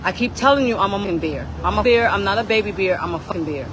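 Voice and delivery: ordinary talk, nothing marked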